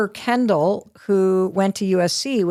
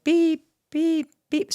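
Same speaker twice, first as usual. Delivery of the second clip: beep imitation; 8 semitones higher, slower than usual. Cashier scanner sounds.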